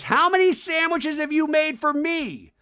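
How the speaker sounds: high, drawn-out mock yell; loud, slow, whiny-high outburst; imitating a famous line. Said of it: "we had the famous".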